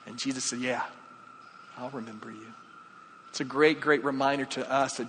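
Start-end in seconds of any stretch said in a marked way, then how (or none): 0.6-2.5 very soft, low-key promise; soft retold assurance. Jesus answers the thief who asked to be remembered, soft assurance. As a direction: drop right down and answer softly.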